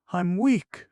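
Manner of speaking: high, loud but spent; Grushenka, exhausted after dancing, high and loud. A narrator's reading. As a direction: say it as if you might faint. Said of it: "she said in an exhausted voice".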